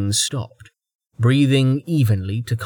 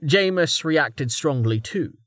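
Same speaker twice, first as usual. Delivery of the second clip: plain reading voice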